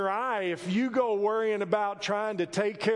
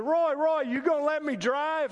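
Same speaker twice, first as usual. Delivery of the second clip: eager call to Roy; 9 semitones higher than usual. Retold or imitated. A greenhorn calling to rancher Roy, begging to drive, eager and raised. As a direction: hail him like a kid begging a turn.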